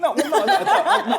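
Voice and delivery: high loud laughing denial; laughing protest. Real, not acted. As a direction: protest loudly through laughter.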